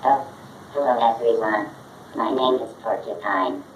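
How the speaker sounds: ordinary talk, nothing marked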